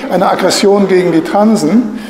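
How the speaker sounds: ordinary talk, nothing marked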